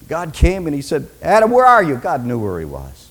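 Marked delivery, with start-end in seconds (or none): 1.2-1.9 raised, louder call; first loud summons; God calling out. Acted. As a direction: call to someone out of sight. God calls to hiding Adam.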